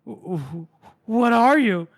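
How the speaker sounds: faint stutter rising into high question; stunned stammer. Acted. Captive to his killer, acted aghast stammer.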